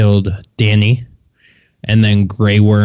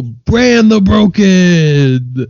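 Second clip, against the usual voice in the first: "Bran the Broken" drawn out very slowly and raised high, like a herald; grand title; 8 semitones higher, 3 dB louder, much slower than usual. Pretend. Laughing friend repeating the nickname, slow mock-grand announcement.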